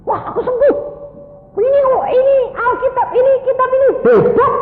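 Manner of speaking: high-pitched, loud excited exclaiming; astonished joy. Acted. Patient realises he is healed, astonished and excited. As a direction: burst out, amazed, in a very high voice.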